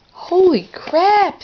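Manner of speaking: high, loud, slow gasp of shock; stunned toy-train exclamation; shocked outburst. Acted. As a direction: drag out the shock at the sight. Thomas sees all the new engines.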